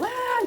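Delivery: high yelp of fake pain; a hammed-up cry of pain; mock scream. Showing viewers the scream she did not do, mock yelp. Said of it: "Eu não tava gritando".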